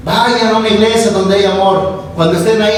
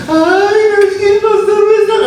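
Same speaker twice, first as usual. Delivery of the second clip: whiny sweetheart; 11 semitones higher than usual. Retold or imitated. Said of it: "los que andan de noviecitos".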